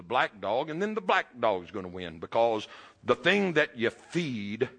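full-voiced pulpit preaching. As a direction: drive the parable home to the congregation. Preaching the two dogs parable.

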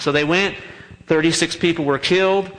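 normal talking voice

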